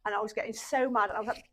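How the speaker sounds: usual speaking voice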